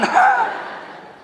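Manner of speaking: a loud, breathy burst of laughter; big laugh. Real, not acted. Preacher laughing at his own story, loud airy laugh.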